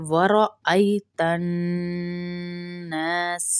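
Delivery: الناس with nasal hum on the ن and long alif; slow ورأيت الناس with nasal ن; ghunna on noon. Shown as a demonstration. Teacher shows ghunna in الناس. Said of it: "نون کے غنہ".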